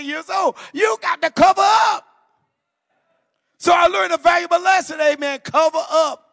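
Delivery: loud, high, shouted preaching climax; preaching at full force. Acted. Preacher shouting to the congregation about covering up. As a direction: hammer the warning home to the church.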